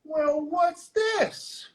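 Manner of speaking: high, drawn-out, taunting sheriff; mock cop voice. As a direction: imitate a cop feigning discovery, very slowly. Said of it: "he takes his flashlight and goes".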